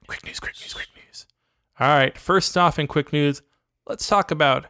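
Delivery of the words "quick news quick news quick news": whispered jingle. Acted. Whispered jingle for quick news.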